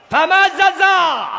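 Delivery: high, slow, drawn-out shout; cried-out verse. Real, not acted. Preacher repeats the verse to the crowd, crying out. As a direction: cry the verse out, holding the long vowels.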